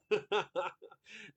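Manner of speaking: high loud laugh; burst of laughter. Real, not acted. Host laughing at a viewer's cheeky guess.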